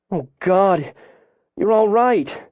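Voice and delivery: high, emotional mother's exclamation; shaken relief. A narrator's reading. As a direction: let relief lift the voice high. Mother finds children safe.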